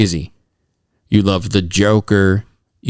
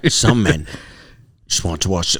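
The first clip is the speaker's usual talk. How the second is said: Michael Caine impression; 5 semitones lower, 4 dB quieter than usual. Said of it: "I can't do it".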